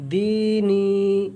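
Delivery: دینی recited slowly with long i held; slow teaching recitation of دینی; long vowels drawn out. Shown as a demonstration. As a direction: draw out both vowels in دینی. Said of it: "اب رواں پڑھتے ہیں".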